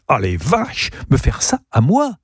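put-on outraged child, pitch leaping at the end; indignant cry. A narrator's reading. Niece denied her métro ride, indignant cry. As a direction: exclaim like a wronged little girl. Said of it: "s'écrie Zazie".

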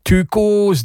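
high, put-on parrot voice; mocking bird voice; squawking parrot. A narrator's reading. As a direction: screech it like a parrot. Parrot speaks in the story.

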